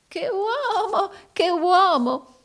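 very high, drawn-out exclamation; affected amusement. A narrator's reading. Arturo hiding his torment from Rodolfo, affected amusement. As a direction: stretch it high as if hugely entertained. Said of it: "affettando, nello strazio ... di divertirsi molto".

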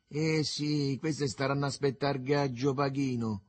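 ordinary delivery, nothing marked

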